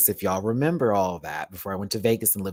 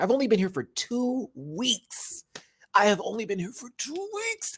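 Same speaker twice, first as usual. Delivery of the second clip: stunned disbelief; 10 semitones higher than usual. Real, not acted. Stunned about the violence.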